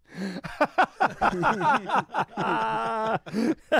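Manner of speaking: long, high burst of laughter; laughing hard. Real, not acted. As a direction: lose it laughing mid-story. Both crack up at the story.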